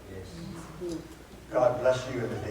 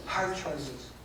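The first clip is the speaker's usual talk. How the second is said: quiet echo; 9 dB quieter than usual. Shown as a demonstration. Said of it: "We're gonna have to make some hard choices".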